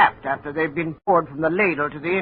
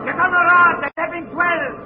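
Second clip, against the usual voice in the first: shouting over noise; 11 semitones higher than usual. Acted. Foreman and new hand shouting orders over the furnace roar. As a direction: yell it across a noisy mill floor.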